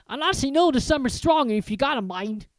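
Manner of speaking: a hearty giant offering tea; Hagrid. Acted. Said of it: "rubbing his hands together".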